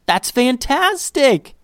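high, drawn-out delight; delighted squeal. A narrator's reading. Liddy hearing about the new puppy, delighted squeal. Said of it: "Liddy squealed".

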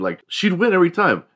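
ordinary talk

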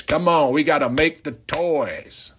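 normal talking voice

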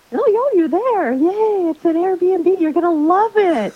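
bubbly over-the-top hostess on the phone; chirpy host voice. Retold or imitated. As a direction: sing it out bright and welcoming like a delighted host. Cheerful host greeting confused guests.

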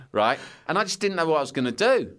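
ordinary talk, nothing marked

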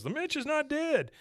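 imitating a pleading owner. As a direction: insist he's alive, desperately. Pig owner voiced in retold episode.

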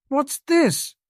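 high-pitched startled question. A narrator's reading. Hermione hears about a godfather on the run, startled. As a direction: pitch leaps up in surprise. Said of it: "Hermione looked startled".

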